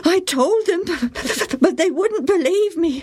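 put-on high, hurt boy's voice; aggrieved boy. A narrator's reading. Joe complaining to his mother, high and hurt. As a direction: plead that it wasn't fair.

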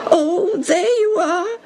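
slow, high-pitched whine; whining. Acted. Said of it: "poor Tim whined".

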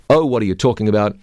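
usual speaking voice

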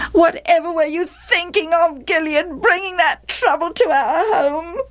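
high-pitched, aggrieved wife; nagging. A narrator's reading. Wife reproaches Gilead about Jephthah, nagging. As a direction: nag on in a high complaining pitch. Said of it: "Gilead's wife nagged".

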